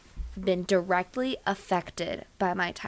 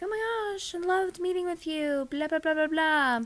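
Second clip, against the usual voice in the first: bubbly email voice; 7 semitones higher, 3 dB quieter than usual. Retold or imitated. Own cheery email voice retold.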